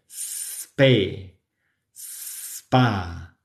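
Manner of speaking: spe spa begun straight on the s; syllable drill. Shown as a demonstration. Teacher drilling s plus p syllables. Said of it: "Lo mismo con la P".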